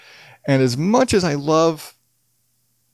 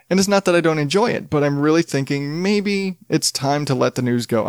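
ordinary talk, nothing marked

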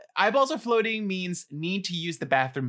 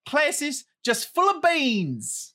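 lively Australian accent, pitched up and louder; a put-on Aussie read; Australian accent, full of energy; 7 semitones higher, 4 dB louder than usual. Shown as a demonstration. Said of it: "I'm doing Australian".

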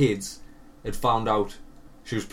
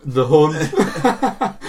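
laughing; 3 semitones higher, 4 dB louder than usual. Real, not acted. Laughing agreement.

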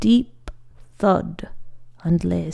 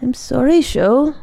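higher, louder, urgent apology; worried apology; 5 semitones higher, 5 dB louder than usual. A narrator's reading. Monk to the friend who fell, worried apology. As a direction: apologise with raised, anxious voice.

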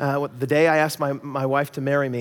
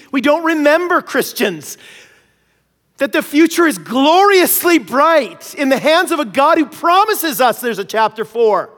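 much higher, louder, passionate preaching; preaching at full force; 10 semitones higher, 8 dB louder than usual. Acted. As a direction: lift it to a soaring sermon peak. Preacher drives the point home.

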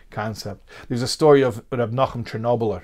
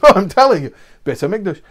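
insistent; 8 semitones higher, 11 dB louder than usual. Acted. Rabbi insists to innkeeper.

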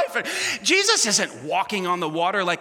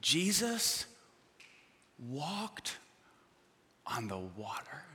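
sinking to a whisper on walked on the water; hushed awe; 7 semitones lower, 13 dB quieter, much slower than usual. Shown as a demonstration. To the church, hushed awe at Jesus walking on water. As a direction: drop to a breath as if it is a wonder. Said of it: "But listen to these words".

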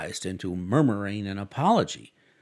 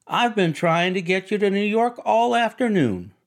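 high, exasperated teasing; Daisy scolding her friend; high, louder Daisy; 9 semitones higher, 6 dB louder than usual. A narrator's reading. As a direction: throw it back in a high voice. Daisy continues retort.

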